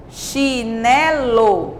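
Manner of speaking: CHINELO very slowly as chi, ne, lo; three separate beats on CHINELO, CH kept together; syllable by syllable. Shown as a demonstration. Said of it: "ficam sempre na mesma sílaba".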